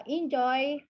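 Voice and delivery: slow emphatic enjoy; stress on enjoy. Shown as a demonstration. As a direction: linger on enjoy.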